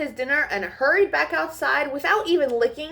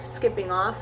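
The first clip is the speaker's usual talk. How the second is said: ordinary delivery, nothing marked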